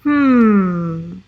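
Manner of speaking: usual speaking voice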